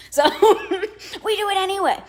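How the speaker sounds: laughing, pitched high. Real, not acted. To viewers owning up to nosiness, laughing. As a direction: giggle through the confession. Laughing admission after whisper.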